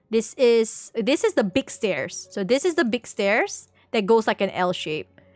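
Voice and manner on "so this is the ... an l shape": ordinary talk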